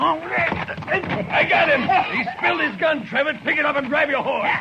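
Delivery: high, laughing cry of triumph; triumphant whoop. Acted. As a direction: crow over the roped man, thrilled. Cowboy ropes Chester, triumphant.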